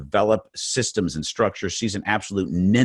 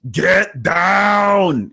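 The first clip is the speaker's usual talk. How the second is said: much higher, loud action-hero yell; bad Arnold Schwarzenegger; 11 semitones higher, 6 dB louder than usual. Shown as a demonstration. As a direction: bark the order like a hammy movie commando. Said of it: "I've got another phrase".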